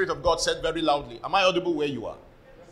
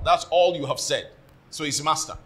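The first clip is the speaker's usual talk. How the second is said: ordinary delivery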